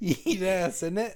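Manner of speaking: high teasing Australian; hammed-up Aussie; mock Aussie accent. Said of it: "Aussie bit continues".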